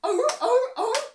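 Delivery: high barking oh; seal bark. Shown as a demonstration. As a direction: honk like a seal. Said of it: "Can you sound like a seal?".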